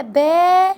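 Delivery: drawn-out با; long alif. Shown as a demonstration. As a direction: stretch the first syllable با out. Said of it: "تعالى اقراها لي كده".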